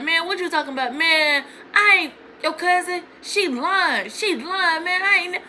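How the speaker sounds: high, protesting mock denial; imitating defensive boyfriend. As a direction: mimic him insisting he's innocent.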